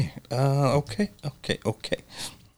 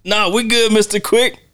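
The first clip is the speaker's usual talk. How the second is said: much higher, sing-song fake politeness; mock Tommy; 10 semitones higher than usual. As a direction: pitch it high and sweet for Mister Quick. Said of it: "every time he says ... that little laugh".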